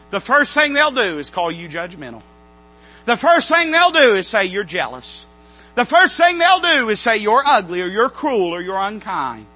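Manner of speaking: loud, ringing preacher's cadence; preaching at full force. Acted. Preacher warning his flock, at full force. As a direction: hammer each warning home from the pulpit.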